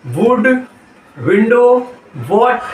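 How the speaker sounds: वुड, विंडो, what each opened with rounded lips. Shown as a demonstration. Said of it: "pronunciation को गोल करना है".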